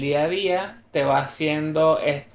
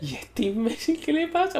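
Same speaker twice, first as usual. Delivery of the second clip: mock indignation; 12 semitones higher than usual. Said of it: "Y yo".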